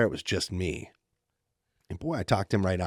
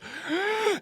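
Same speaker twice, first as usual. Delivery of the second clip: high-pitched mock sob gasp; imitated crying gasp. Nephew crying after falling off the bed, imitated crying gasp. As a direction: squeal like a crying child. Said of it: "he's crying".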